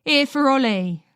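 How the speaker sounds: wife shouting back to her husband inside. A narrator's reading. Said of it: "Margaret shouts".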